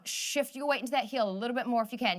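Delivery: ordinary talk, nothing marked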